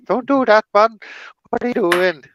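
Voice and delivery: high, loud mock telling-off; high-pitched exasperated cartoon mum; scolding cartoon mother. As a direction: scold a naughty kid in a high voice. Acting Fireman Sam mother scolding Norman.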